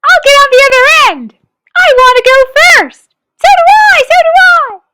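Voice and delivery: put-on high, loud squabbling kids; children shouting with excitement. A narrator's reading. Children crowding the seesaw: high excited shouts. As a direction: everyone wants the first go. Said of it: "shouting with excitement".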